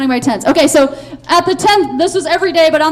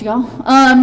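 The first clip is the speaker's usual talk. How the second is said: normal talking voice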